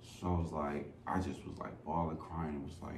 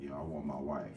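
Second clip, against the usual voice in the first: tearful plea; 3 semitones lower, 9 dB quieter, faster than usual. Retold or imitated. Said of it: "I was just crying".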